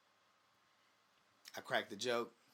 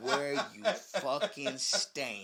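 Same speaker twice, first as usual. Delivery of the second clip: slow mock threat, much higher and louder, laughing through it; 9 semitones higher, 13 dB louder, much slower than usual. Telling A J Styles off after his joke, laughing mock threat. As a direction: ham up the menace while cracking up. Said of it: "I said".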